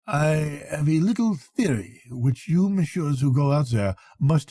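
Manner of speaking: put-on lightly accented French doctor, unhurried; a hint of French in a proud doctor's lecture. French doctor tells the Englishman about his theory, lightly accented.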